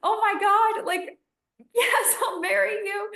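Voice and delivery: high, giddy, laughing yes to a proposal; overjoyed acceptance. Retold or imitated. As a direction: gush your acceptance, dizzy with joy. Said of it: "blacking out on stage".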